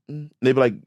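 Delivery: usual speaking voice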